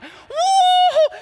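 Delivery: very high, loud celebratory whoop; hollering whoop. Retold or imitated. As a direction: whoop like a fan at a grand slam. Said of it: "we were just hollering".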